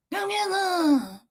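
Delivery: slow, high, eerie call; mock scary call. Showing what would scare strangers, high eerie drawn-out call. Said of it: "You would scare the".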